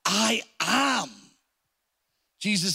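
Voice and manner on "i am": "I am" declared higher, both words given weight; emphatic announcement. Shown as a demonstration. Jesus announcing who he is, emphatic "I am". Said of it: "eimi is that".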